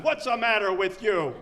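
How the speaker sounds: exasperated mimic of everyone asking; mock nagging question. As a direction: fling the question at him like a nag. People asking him constantly.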